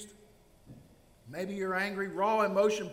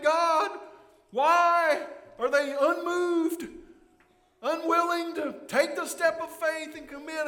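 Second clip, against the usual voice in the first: pleading prayer; 8 semitones higher, 6 dB louder than usual. Acted. Congregation praying for unmoved friends.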